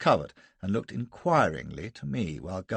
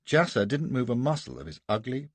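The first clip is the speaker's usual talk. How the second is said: normal talking voice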